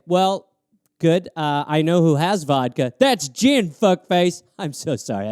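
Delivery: ordinary talk, nothing marked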